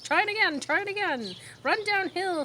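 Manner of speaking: high, eager cow voice; cows suggesting. A narrator's reading. Cows urging Wilbur downhill, high and eager. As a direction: call encouragement from the field. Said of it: "suggested the cows".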